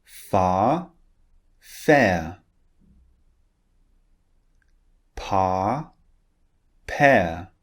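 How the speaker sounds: "Far" with a long ah vowel and "fair" with a gliding air vowel, no R in either; minimal pairs. Shown as a demonstration. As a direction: contrast each pair slowly, ah then air, R silent. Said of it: "listen to the pairs and repeat after me".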